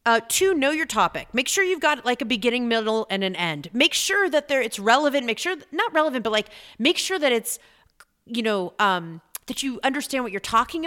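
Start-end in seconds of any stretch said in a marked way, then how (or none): none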